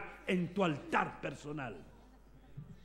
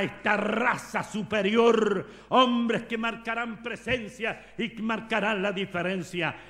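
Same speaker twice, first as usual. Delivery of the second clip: loud building sermon cadence; preaching at full force; 3 semitones higher, 6 dB louder than usual. Acted. Pastor rousing congregation, loud fervent preaching. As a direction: drive it home to the congregation.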